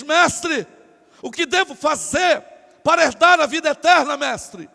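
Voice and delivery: declaimed public question. Acted. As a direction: call out to the master for all to hear. Acted public question to the master. (pergunta declamada em voz alta)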